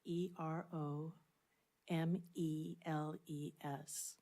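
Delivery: slow, measured letters with a gap between groups. Real, not acted. Spelling the genus for a colleague who asked, slowly. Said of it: "can you say that slower".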